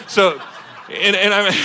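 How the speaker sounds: words breaking into a laugh. Real, not acted. Pastor laughing at his Michigan relatives' speech, real laughter.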